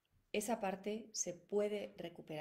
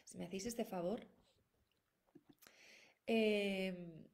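usual speaking voice

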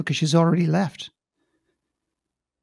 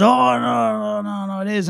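higher-pitched drawn-out string of denials; mock protest, starting high and easing off; retold protest; 4 semitones higher than usual. Man brushing off the speaker's doubt, retold protest.

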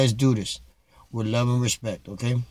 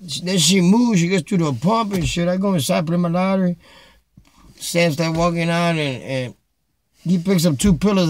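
usual speaking voice

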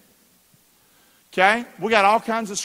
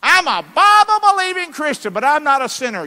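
high, loud mocking voice of a smug believer; mock churchgoer; 9 semitones higher, 8 dB louder than usual. Preacher mocking a smug believer, high mock voice.

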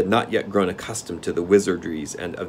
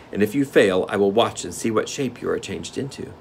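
ordinary delivery, nothing marked

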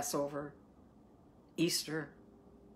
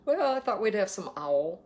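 higher, hammed-up domestic answer; mock spouse reply; 5 semitones higher, 4 dB louder than usual. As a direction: offer the menu in a put-on voice. Acts out spouse's dinner answer.

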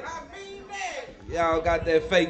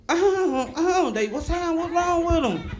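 much higher, laughing confusion; high shocked women; 14 semitones higher, 5 dB louder than usual. Retold or imitated. Retelling the women at the door, high laughing outrage. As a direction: squeal it like the offended women.

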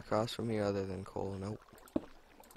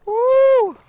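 excited whoop; 17 dB louder than usual. Real, not acted. Cheering at diamonds.

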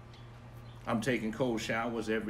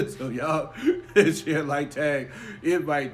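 laughing; 4 semitones higher, 7 dB louder, slower than usual. Real, not acted. Laughing through the reminder.

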